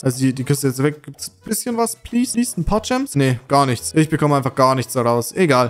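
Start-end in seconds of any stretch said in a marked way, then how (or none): 1.0-3.1 high, pleading begging voice; whiny high-pitched plea. Real, not acted. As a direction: beg the game sweetly for gems. Begging the game for gems.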